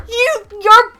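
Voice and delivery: high, loud, slow disbelief with a laugh; astonished, laughing disbelief; amazed laugh. Real, not acted. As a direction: laugh out loud in shock at the coincidence. Reacting to birthday coincidence.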